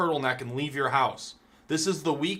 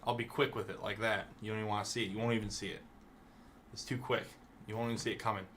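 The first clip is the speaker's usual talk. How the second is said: menacing quiet; 5 semitones lower, 10 dB quieter, faster than usual. Acted. Mock threat to a viewer.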